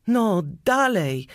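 mildly shouted command; urging shout. A narrator's reading. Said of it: "krzyknął woźnica".

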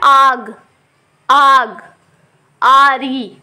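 आग and आरी with a loud, long आ; long aa demo. Shown as a demonstration. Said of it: "आ की sound बड़ी आ रही है". (आग, आरी में लंबा, ज़ोरदार आ)

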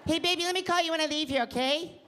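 put-on sappy phone voice for the girlfriend. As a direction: coo at her like a Muppet. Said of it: "really bad girlfriend voice".

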